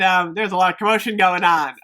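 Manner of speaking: ordinary delivery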